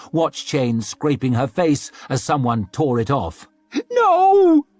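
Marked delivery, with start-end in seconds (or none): 3.9-4.7 very high, loud squeal; a high, loud desperate squeal. A narrator's reading. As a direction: refuse in terror. Dragged away.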